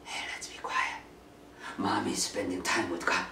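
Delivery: whispered hush, slow at the end; hushed children's whisper. Retold or imitated. Kids hushing each other while mom prays, whispered.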